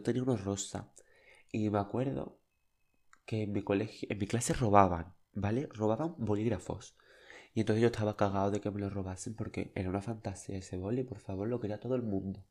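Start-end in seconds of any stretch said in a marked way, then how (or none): none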